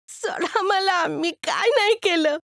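put-on a scared kid begging to be let go; frightened street boy. Caught boy pleading with Bhaskarrao to let him go, high and frightened.

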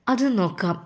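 plain reading voice

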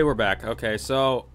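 ordinary talk, nothing marked

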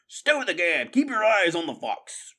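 loud, high-pitched angry snarl; snarled order. A narrator's reading. Bossy rat silences his crony, snarling. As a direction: snap at the underling to shut up. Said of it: "Redtooth snarled".

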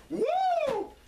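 high, shrieking wrestler's woo; a strutting champion's woo; Ric Flair woo. Acted. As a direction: let out the signature Flair woo. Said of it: "Ric Flair would break into my house".

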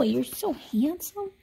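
slow, tender whisper; whispered tenderly. Real, not acted. Saying goodbye to Ziggy in a slow, tender whisper. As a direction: breathe it softly to the dog.

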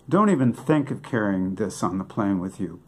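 ordinary talk

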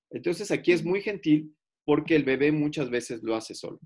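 usual speaking voice

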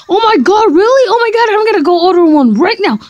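high, loud and fast gush; overexcited squeal. Acted. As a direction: burst out with glee. Overexcited about eBay.

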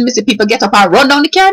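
usual speaking voice

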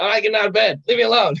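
put-on stubborn kid digging in; defiant refusal. Kid refusing to leave bed.

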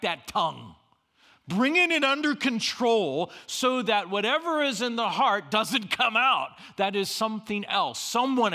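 fervent pulpit preaching with slowed words; full-force preaching. Acted. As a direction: preach it hard to the room.

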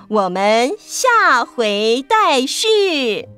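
ordinary delivery, nothing marked

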